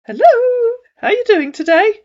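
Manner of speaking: high, bright-voiced chat-up hello; high loud friendly hello; scammer greeting. A narrator's reading. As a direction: greet her like a smooth chat-up man.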